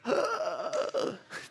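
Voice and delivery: long, high mock gasp; shocked gasp. Teachers hearing students aim for a B, mock-horrified gasp. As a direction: suck in air as if scandalized.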